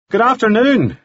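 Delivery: high, carrying call across the ridge; called out greeting. A narrator's reading. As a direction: call it up to the stranger below. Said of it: "he called".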